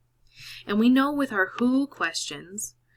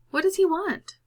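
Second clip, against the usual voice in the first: high prompting question; 11 semitones higher, 5 dB louder than usual. Real, not acted. Teacher prompts class.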